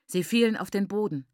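ordinary delivery, nothing marked

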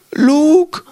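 a distant princess voice pleading in the head, high and slow; Princess Leia. Retold or imitated. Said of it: "die Stimme von Prinzessin Leia".

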